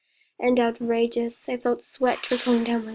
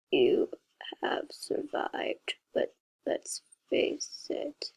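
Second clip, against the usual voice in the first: creepy whisper; 8 dB quieter, much slower than usual. A narrator's reading. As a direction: breathe it out like a monster. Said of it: "like a disturbing robot".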